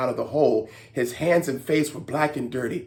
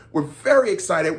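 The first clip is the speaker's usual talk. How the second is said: ordinary delivery, nothing marked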